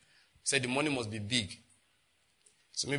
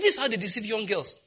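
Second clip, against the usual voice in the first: angry; 7 semitones higher than usual. Retold or imitated. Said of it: "I was so angry".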